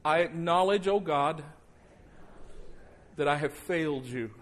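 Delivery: fervent guided prayer; leading prayer. Acted. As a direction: lead them in prayer, line by line. Leads congregation in prayer.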